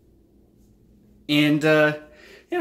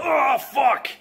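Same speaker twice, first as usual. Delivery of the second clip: higher, sudden acted yelp of pain; mock pained yell; 10 semitones higher than usual. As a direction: act the toe stub with a high yelp. Stubbing a toe on the bed.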